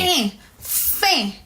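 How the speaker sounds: EH and FIN with nasal "ain" vowel; nasal sound. Shown as a demonstration. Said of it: "It's more of a nasal sound".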